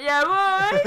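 loud, high call; calling the brother up the staircase, loud and high; calling up the stairs. Retold or imitated. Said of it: "to the staircase, like".

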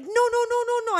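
high frantic no no no; panicked no. Retold or imitated. As the Italian guy watches her jump in, a high frantic no no no. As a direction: squeal it in alarm as she jumps. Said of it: "and he's like".

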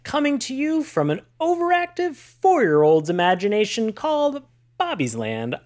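big bright show-host intro; announcer voice. Acted. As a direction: sell it like a radio announcer. Podcast intro, announcer read.

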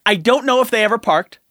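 ordinary delivery

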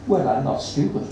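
ordinary delivery, nothing marked

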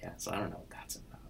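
fast conspiratorial whisper; a hushed, hurried aside. Real, not acted. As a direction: whisper it quickly, as a side comment. Whispered aside about the Lloyds.

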